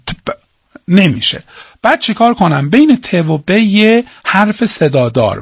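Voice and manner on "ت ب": ت and ب as bare consonant sounds, no vowel between; clipped letter sounds ت، ب with no full vowel. Shown as a demonstration. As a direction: sound out ت then ب without joining them.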